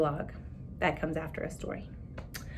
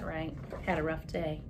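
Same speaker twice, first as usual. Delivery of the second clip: plain reading voice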